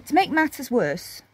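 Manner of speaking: ordinary delivery, nothing marked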